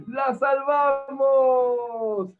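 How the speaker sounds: high, long-drawn victory cry; loud drawn-out celebration; jubilant cheer. A narrator's reading. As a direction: cheer it out, stretching the last vowel. Children saved the whale.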